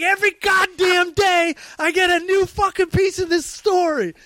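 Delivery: high, exasperated mock shout; retold furious rant; imitated cracking up. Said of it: "finally cracked after seven days".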